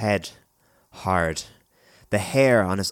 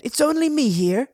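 put-on boy calling out; 5 dB louder than usual. A narrator's reading. Boy tells hidden children he is alone, high raised voice. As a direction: call reassurance in a high voice.

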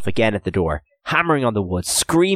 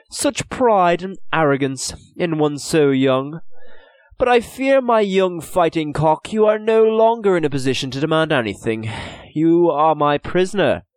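smug merchant; 7 semitones higher than usual. A narrator's reading. Merchant Birmingham gloating over his prisoners, smug. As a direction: gloat over the captured boys.

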